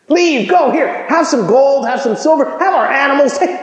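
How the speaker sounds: high, pleading begging voice; desperate Egyptians. Retold or imitated. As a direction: beg them to leave now. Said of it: "the Egyptians ... said".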